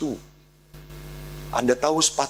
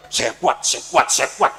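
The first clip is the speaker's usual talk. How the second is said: high strained chant of courage; bracing self-talk; 6 semitones higher, 4 dB louder than usual. Retold or imitated. Boy in the bathroom bracing himself, strained and high. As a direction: psych yourself up, tight and high.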